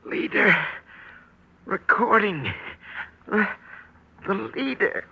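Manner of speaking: breathless, dragged-out dying gasps; barely voiced, very slow words from a dying man; dying whisper. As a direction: force each word out with your last breath. Dying man whispers his clue.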